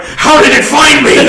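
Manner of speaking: loud mock panic. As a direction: blurt it out as if hunted by the cat. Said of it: "Very, very loudly".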